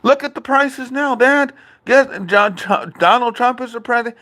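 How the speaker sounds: high mock whining; imitating dad's complaint. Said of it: "he was like".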